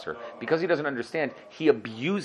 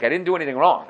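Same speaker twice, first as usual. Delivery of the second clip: pretend innocence; 6 semitones higher, 5 dB louder, faster than usual. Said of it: "If you pretend like".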